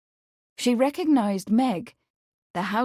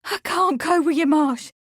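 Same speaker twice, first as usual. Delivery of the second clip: high, loud, distressed protest; desperate; 9 semitones higher, 4 dB louder than usual. A narrator's reading. Meg refuses to leave with Marsh, desperate. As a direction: cry it out desperately, climbing higher. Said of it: "Her voice grew desperate".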